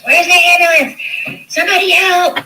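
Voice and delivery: high, loud desperate shouting; frantic call for help. Retold or imitated. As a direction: yell for an ambulance in panic. Neighbors calling for ambulance.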